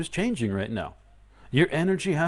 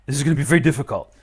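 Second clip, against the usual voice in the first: mock gasp; 4 semitones higher, 15 dB louder than usual. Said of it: "if you're inclined to go".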